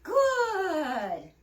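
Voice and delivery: high, loud "Good." to the dog; praise. Real, not acted. Trainer praising the dog, high and loud. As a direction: sing out the praise to reward the dog.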